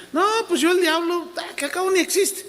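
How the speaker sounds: voz aguda de ingenuo despreocupado; cristiano inocente. Acted. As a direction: imita en agudo a un creyente confiado. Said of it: "esos cristianos inocentes que dicen". (parodia de cristiano inocente, voz muy aguda)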